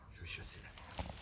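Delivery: low-volume, slow whisper; hushed hunter's resolve; stealthy whisper. Acted. Hunter confiding his plan to chase the bear, whispering.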